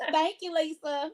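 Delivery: high, laughing thank you; delighted thanks. Real, not acted. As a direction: thank her through a laugh. Thanking a commenter.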